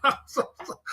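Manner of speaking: breathless laugh through words. Real, not acted. Laughing while telling viewers about the hangover.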